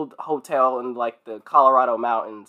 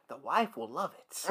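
unhinged Jack; 15 dB quieter than usual. Retold or imitated.

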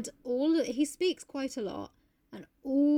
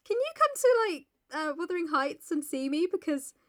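high-pitched mock plea; comically high, rising to loud despair; hammed-up cry for help; Isabella's letter; 11 semitones higher, 5 dB louder than usual. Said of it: "basically being like".

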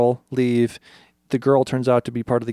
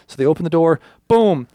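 normal talking voice

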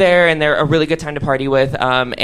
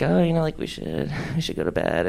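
quiet suggestive invite; 8 dB quieter than usual. Retold or imitated. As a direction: lay on a hushed come-to-bed tone. Inviting the girl to bed.

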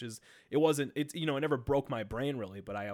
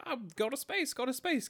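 much higher, giddy robot chant; broken space core; 11 semitones higher than usual. Retold or imitated. Gamer imitating the space core, high giddy chant. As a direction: chirp it like a broken little robot. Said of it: "they're like".

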